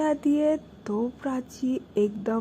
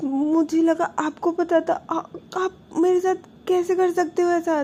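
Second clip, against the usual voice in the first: hurt crying plea; 10 semitones higher, 6 dB louder than usual. Retold or imitated. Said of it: "Prachi जो रोना start करती है".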